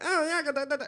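high, mocking babble; mocked braggart. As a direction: imitate a boaster in a put-on high voice. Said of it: "that you fucking are like".

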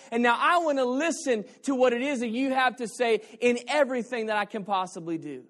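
loud, high, driving sermon voice; impassioned pulpit preaching; full-force preaching. Real, not acted. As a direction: preach it out with rising fervour.

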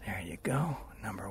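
unvoiced whisper to herself while shooting a photo. Real, not acted. Whispering to herself while snapping the sunset. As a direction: breathe it out as the shutter goes.

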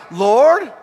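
loud, high plea rising into a question; incredulous appeal. Retold or imitated. Pastor appealing to God about a drunk stranger, incredulous. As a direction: call upward in comic disbelief. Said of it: "And I'm like".

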